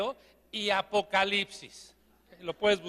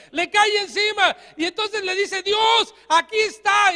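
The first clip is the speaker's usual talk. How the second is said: higher, louder, driving the story; loud preaching; 7 semitones higher, 5 dB louder than usual. Acted. Preacher retells the prophet's story, loud and high. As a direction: preach it out with force.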